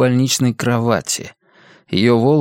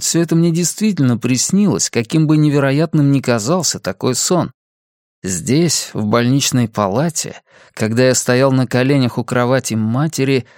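usual speaking voice

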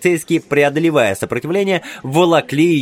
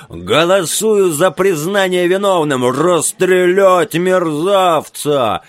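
loud, slow barked verdict; furious shout; 5 semitones higher, 3 dB louder, slower than usual. A narrator's reading. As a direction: bellow for the execution. Major demands execution.

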